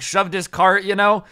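ordinary talk

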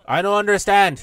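loud puzzled call. Real, not acted. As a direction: yell back at the radio. Yelling at a faint radio voice.

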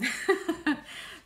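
short, high, loud laugh; tearful laugh. Real, not acted. Thanking a friend for a birthday gift, embarrassed tearful laugh. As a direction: laugh it off to keep from crying. Laughs off her happy tears.

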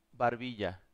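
ordinary talk, nothing marked

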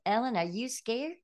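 quoting a Southern friend, with a hint of her Southern accent. Best friend asking Ellen if she is scared, in a light Southern accent. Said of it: "in her Southern accent".

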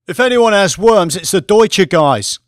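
a loud, high mocking jab at rivals; joking jab. Jensen teasing the Deutsche traders, loud joking jab. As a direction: call it across the room to get a laugh. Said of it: "joked Jensen".

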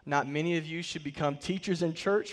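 ordinary delivery

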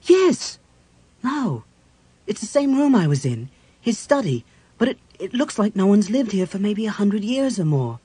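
high, loud, urgent reasoning; agitated realization. A narrator's reading. Cleric works out when they have landed, agitated and loud. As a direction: think aloud in rising alarm.

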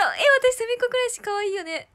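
excited gush. Real, not acted. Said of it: "日本語おかしかった".

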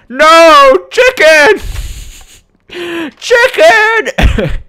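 high loud mock anguished cry; hammed-up anguish. Gamer crying out over his dead chicken, hammed up. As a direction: cry out in over-the-top grief.

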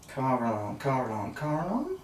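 soft, absent-minded repetition while searching; muttering to self. Real, not acted. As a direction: murmur it as you scan the page. Searches rule book, muttering to self.

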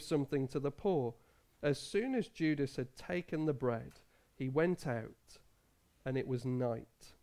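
low, soft scripture reading; Bible reading. Acted. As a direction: read the passage with quiet gravity.

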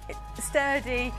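ordinary delivery

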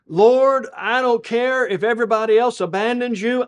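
loud, high-pitched bold vow; Peter. Acted. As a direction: declare loyalty with preacher's force. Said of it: "stands with Jesus and he says".

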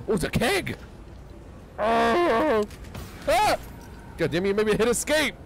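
high, loud startled yells; jump scare. Real, not acted. Gamer scared by a keg, loud high startled yells. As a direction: yelp as the keg scares him.

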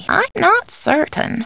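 high, drawn-out uncertainty; hesitant take. Acted. Housekeeper unsure of an answer, high and hesitant. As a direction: lift it high and slow it down. Alternate takes of stock line.

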